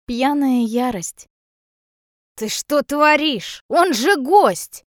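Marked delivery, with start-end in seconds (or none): 2.4-4.8 put-on high, loud, slow drunken outrage; furious scolding. A narrator's reading. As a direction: scold with stretched-out words. Drunk mother furiously scolds daughter.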